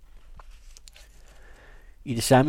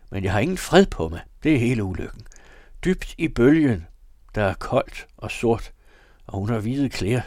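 agitated, raised voice with high, loud openings; wild shouting; 4 semitones higher, 5 dB louder than usual. A narrator's reading. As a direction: rave in loud bursts, as if cornered. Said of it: "råbte han".

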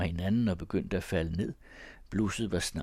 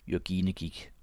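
plain reading voice

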